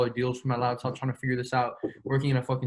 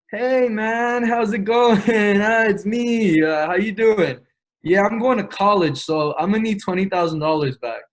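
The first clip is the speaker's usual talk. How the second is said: higher and louder, chirpy and overly friendly; fake friendly phone voice; 7 semitones higher, 6 dB louder than usual. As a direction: sell a cheery lie to the investor on the phone. Phones investor with fake friendly act.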